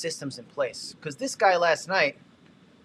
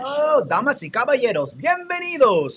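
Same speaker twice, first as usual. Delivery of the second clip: booming high announcer voice in Spanish; lucha libre announcer; 10 semitones higher, 8 dB louder than usual. Acted. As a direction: call it out like a wrestling ring announcer. Announcer voice after Spanish bot.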